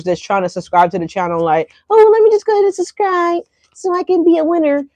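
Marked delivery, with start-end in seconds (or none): 1.9-4.8 mocking voice. Mocking fake subscribers.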